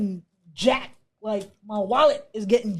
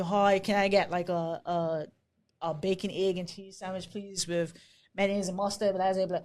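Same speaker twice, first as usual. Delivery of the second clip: a light Bermudian lilt on the order; ordering at the bodega in Bermudian English; Bermudian accent; 3 semitones lower, 4 dB quieter than usual. Retold or imitated. Newcomer ordering breakfast at a Brooklyn bodega, in a Bermudian accent. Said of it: "I'm talking in my Bermudian accent".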